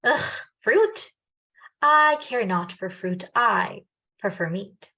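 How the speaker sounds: mild disgust at fruit, slow and haughty; disgusted crocodile. Acted. Crocodile refusing the monkey's fruit, lightly disgusted. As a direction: turn your nose up, then slowly decline. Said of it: "scrunched up his nose and said".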